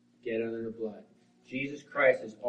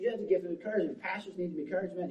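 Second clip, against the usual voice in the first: high, excited retelling of encouragement; 8 semitones higher, faster than usual. Retold or imitated. Wife naming her husband's gift, retold in excitement. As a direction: voice lifted, eagerly encouraging. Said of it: "she's getting excited".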